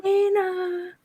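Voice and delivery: high Philly call of Dana; Philly mom yell. Retold or imitated. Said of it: "In her Philly accent".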